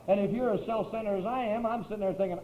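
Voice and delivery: ordinary delivery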